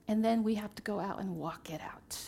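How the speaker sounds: usual speaking voice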